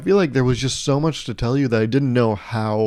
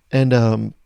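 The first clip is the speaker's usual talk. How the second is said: ordinary delivery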